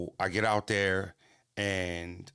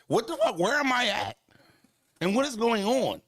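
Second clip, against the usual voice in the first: shocked disbelief; 12 semitones higher than usual. Retold or imitated. Recalling his shock at the fight in Toronto, high stunned outburst. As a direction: squawk it like you can't believe where you landed.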